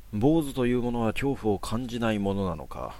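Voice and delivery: plain reading voice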